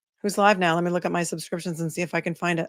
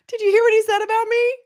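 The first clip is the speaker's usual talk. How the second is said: much higher, cartoonish squeak; high squeaky mock outrage; 4 dB louder than usual. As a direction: play a squeaky outraged little voice. Mocking squeaky voice, reacting to clip.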